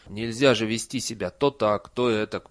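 plain reading voice

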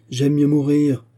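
loud, high-pitched refusal; defiant cry. A narrator's reading. Fugitive refusing to surrender, defiant cry. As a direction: call back a defiant refusal.

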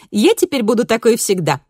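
lightly voiced cheerful teen girl; bright daughter voice. A narrator's reading. Daughter promising.

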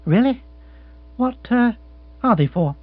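chirpy lord offering comfort; young lord voice. Acted.

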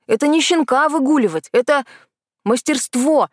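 возмущённо и повыше; горячо отстаивает своё дело; завёлся. Acted. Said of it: "Кайран завёлся". (запальчиво)

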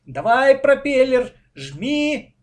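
loud, high cheer; thrilled child spectator's yell. A narrator's reading. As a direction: urge your boat on excitedly. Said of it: "обрадовался Коля".

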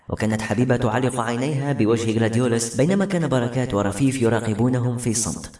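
ordinary delivery, nothing marked

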